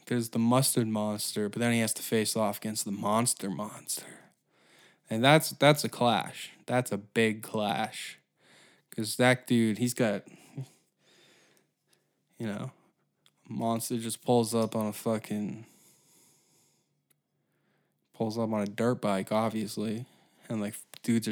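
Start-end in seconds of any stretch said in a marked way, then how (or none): none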